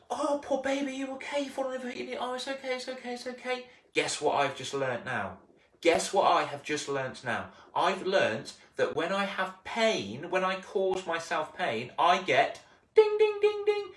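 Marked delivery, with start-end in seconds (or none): 0.1-3.6 put-on much higher, soothing baby talk; cooing mum. Mum soothing a child with a hurt knee, much higher. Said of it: "Mum comes up to me and goes". 12.0-13.8 much higher sung ding; mock bell. Teacher mimicking a reward bell, high and sung.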